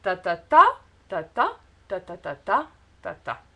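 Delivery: "ta ta ta" chanted in short groups, each last "ta" stressed; rhythm demonstration. Shown as a demonstration. Teacher demonstrating French speech rhythm, stress ending each "ta" group. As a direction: beat out "ta" syllables, leaning on the end of every group. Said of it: "votre rythme de parole doit être".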